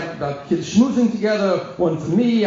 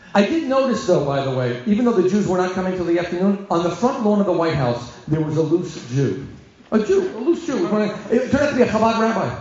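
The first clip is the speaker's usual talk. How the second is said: normal talking voice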